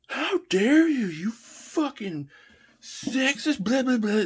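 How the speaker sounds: very high, shrill-sounding mock outrage, slow at first; outraged woman. Girlfriend's friend scolding the cake joke, high mock outrage. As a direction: shriek it like an offended stranger. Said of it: "one of her friends is just like".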